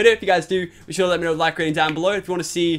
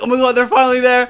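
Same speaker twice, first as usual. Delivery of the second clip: higher, louder excited exclamation; excited retelling; 8 semitones higher, 6 dB louder than usual. Retold or imitated. Brother recalls watching late-night gaming, excited. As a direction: relive the thrill of the moment.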